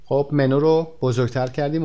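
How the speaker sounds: ordinary talk, nothing marked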